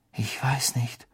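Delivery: whispered. Acted. Answer beside unconscious Ron.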